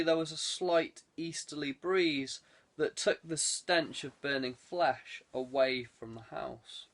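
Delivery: ordinary delivery, nothing marked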